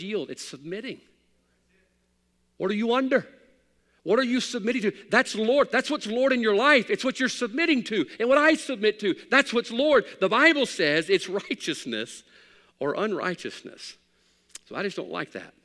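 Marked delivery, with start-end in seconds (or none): 2.6-14.0 loud, high preaching cadence; fiery sermon. Acted. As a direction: preach it hard to the congregation. Preaching at full force.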